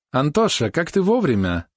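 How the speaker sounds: high, bright joyful greeting; delighted. A narrator's reading. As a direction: greet him with open delight. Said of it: "явно обрадовалась его появлению княгиня". (радостно, на высоком тоне)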